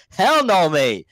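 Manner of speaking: parents yelling no. Retold or imitated. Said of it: "They'll be like".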